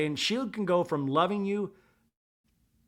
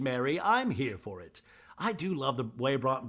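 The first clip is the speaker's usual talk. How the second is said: ordinary talk, nothing marked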